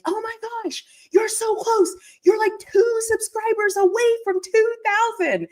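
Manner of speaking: high, squealing imitation of an excited sister; excited younger sister. Younger sister shouting the news about subscriber count, high excited squeal. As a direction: play the kid sister bursting with the news. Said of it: "And she's like".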